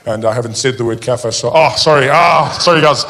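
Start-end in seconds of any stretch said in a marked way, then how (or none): none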